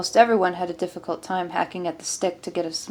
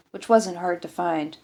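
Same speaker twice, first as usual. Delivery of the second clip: usual speaking voice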